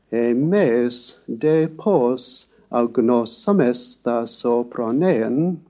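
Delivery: "Emes de pos agnosamesta sopranean" said slowly with rising and falling glides; slow, sung-out pitch accents on "Emes de pos agnosamesta"; pitch-accented Greek. Shown as a demonstration. Lecturer demonstrating the line's melody, slow pitch glides. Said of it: "as in the last line I spoke".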